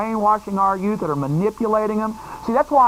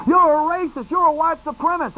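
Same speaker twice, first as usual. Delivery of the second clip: higher, mock accusing yell; higher mock yell of accusation; imitating a screaming heckler; 8 semitones higher than usual. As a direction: parody the guy screaming at him. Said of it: "is down there screaming bloody murder".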